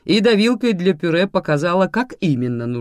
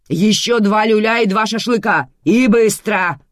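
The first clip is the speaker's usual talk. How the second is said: high, loud sarcastic tirade; shouting; 9 semitones higher, 9 dB louder than usual. A narrator's reading. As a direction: yell impatient questions, then the order. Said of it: "продолжала кричать Манана".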